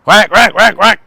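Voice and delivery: short loud imitated quacks; duck quacking. Shown as a demonstration. Quacking like a duck again for the retrieve drill, loud. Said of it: "I'm gonna quack like a duck".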